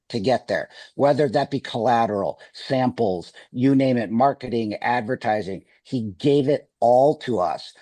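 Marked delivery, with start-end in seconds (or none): none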